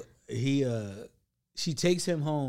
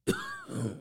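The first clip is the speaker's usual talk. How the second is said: a mock hacking cough; imitated cough; 5 dB quieter than usual. Imitating the old man's coughing fit, mock hacking cough. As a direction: cough like the old man choking.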